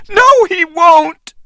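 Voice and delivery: very high defiant cry. A narrator's reading. Anne contradicting Jack, defiant cry. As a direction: insist against him. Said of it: "she cried".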